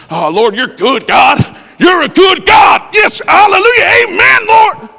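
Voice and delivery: shouting praise at the top of his lungs; hammed-up shouted prayer, high and loud; loud praying.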